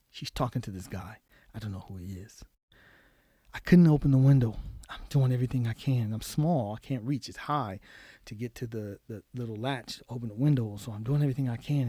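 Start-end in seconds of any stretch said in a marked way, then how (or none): none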